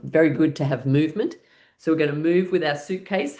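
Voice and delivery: ordinary talk, nothing marked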